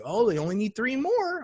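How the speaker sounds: lightly voiced small child; young daughter's line. Voicing his young daughter in story.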